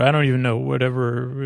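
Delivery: usual speaking voice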